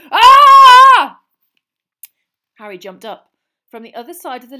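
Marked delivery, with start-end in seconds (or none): 0.1-1.2 very loud, high "aah" scream; startled scream. Acted. Uncle cut off by noise in the fireplace, startled scream. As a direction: scream out in sudden shock.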